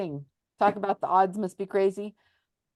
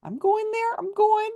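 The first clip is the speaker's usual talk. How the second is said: put-on whiny kid; 11 semitones higher than usual. Mock kid protest.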